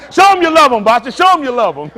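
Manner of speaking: loud, high, laughing encouragement; excited cheering. Real, not acted. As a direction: shout it like a rowdy supporter.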